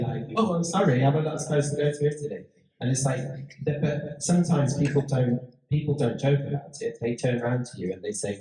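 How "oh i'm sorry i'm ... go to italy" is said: higher, quick, flustered sorry; mock apology. Speaker imitating someone apologising to her, a hurried mock apology. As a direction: rush the apology in a raised, bright voice. Person apologising after a misunderstanding.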